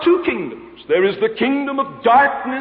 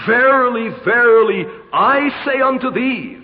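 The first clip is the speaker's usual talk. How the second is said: "Verily, verily" louder and weighted before "I say unto thee"; stress on verily; 2 semitones higher, 3 dB louder than usual. Shown as a demonstration. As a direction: preach the doubled "Verily" like a red underline. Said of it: "adds to his own words these verily, verilies".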